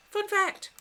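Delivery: high, loud burst of excitement; excited. Pretend. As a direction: shout it like big news. Reading chat with excitement.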